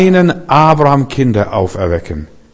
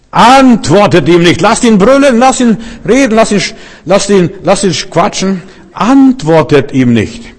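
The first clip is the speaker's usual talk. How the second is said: eindringliche Anweisung; 8 semitones higher than usual. Retold or imitated. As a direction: urge the people firmly, rising to a cry on brüllen. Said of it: "Das ist die Reaktion". (lauter, hoher Befehl des Königs)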